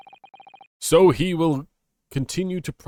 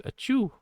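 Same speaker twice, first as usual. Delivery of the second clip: much higher, hammed-up sneeze; mock sneeze; 14 semitones higher, 3 dB quieter than usual. Player fakes a sneeze for a German joke, mock sneeze. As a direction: let a fake sneeze fly. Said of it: "a cold in nineteen forties Germany".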